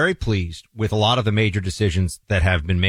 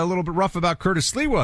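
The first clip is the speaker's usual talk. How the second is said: ordinary delivery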